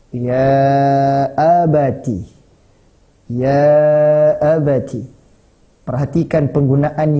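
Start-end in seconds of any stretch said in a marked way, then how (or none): 0.1-5.0 very slow, long-held first vowel; drawn-out address. Acted. Son Ismail answering his father, slowly drawn out. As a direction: stretch the call out like a recitation.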